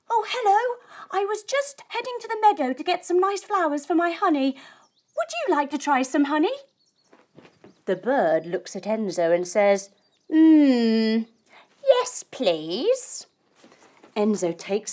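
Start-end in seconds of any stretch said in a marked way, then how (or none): 0.1-6.6 higher, nervous friendly bee; lightly voiced little bee. Bee Enzo answers bird. 10.3-13.3 considering hmm then polite; lightly voiced bird. Bird accepts honey.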